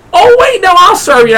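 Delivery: very high, flustered mock voice; high-pitched caricature of an apologetic clerk; imitated counter lady. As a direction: backtrack hurriedly as the counter lady.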